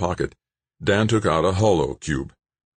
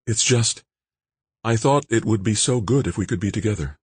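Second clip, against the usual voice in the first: higher, wistful woman's voice; Jane wistful; 6 semitones higher than usual. Acted.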